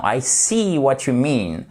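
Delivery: "see" stretched into a long ee; long English ee. Shown as a demonstration. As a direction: draw the vowel out. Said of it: "il est un peu plus étiré". ("see" prononcé avec un i long étiré)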